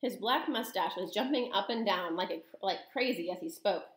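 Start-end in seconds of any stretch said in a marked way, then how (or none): none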